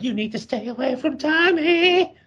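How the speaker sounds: very high, put-on mother's warning; mock frantic mom. Mom stopping Grandpa in the road, hammed-up high plea. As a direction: squeal it as a hammed-up mom, last word stretched. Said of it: "she's just like".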